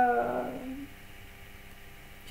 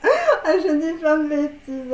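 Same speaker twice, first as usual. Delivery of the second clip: laughing; slower than usual. Real, not acted. Laughs at own joke.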